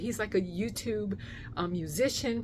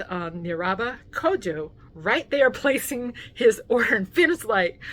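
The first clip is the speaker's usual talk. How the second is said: usual speaking voice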